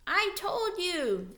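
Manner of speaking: high, slow and impatient. Acted. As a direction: spell it out, fed up. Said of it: "Ralph said impatiently".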